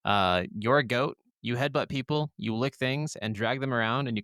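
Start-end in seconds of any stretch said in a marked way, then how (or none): none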